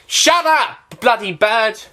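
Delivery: real high, loud outburst; annoyed yell. Real, not acted. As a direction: shout angrily at a noisy bird outside. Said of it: "got a really loud bird outside".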